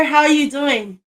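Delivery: ordinary talk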